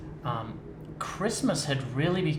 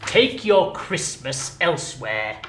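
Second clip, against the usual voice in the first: loud, slow curt goodbye; angry miser dismissing; cold dismissal; 5 semitones higher, 12 dB louder, slower than usual. Acted. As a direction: show him the door. Scrooge dismisses.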